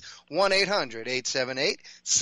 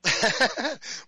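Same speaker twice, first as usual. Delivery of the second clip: short laugh; 5 semitones higher than usual. Real, not acted. Host laughs at co-host's story.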